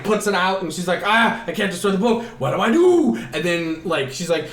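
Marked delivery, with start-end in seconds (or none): 1.0-3.2 rising mock-desperate wail; frantic. Mother unable to destroy the book, frantic. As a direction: end on a high, loud helpless cry.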